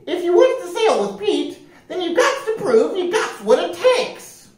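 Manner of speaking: very high parrot voice; announcing parrot. A narrator's reading. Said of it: "announced the parrot".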